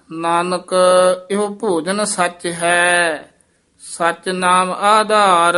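measured chanting recitation of Gurbani; scripture recitation. Shown as a demonstration. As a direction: recite each phrase slowly and evenly.

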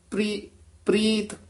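ਪ੍ਰੀਤ said lightly with the half r tucked in; ਪ੍ਰੀਤ with the subscript r. Shown as a demonstration. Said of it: "ਇਸ ਤਰ੍ਹਾਂ ਬੋਲਣਾ".

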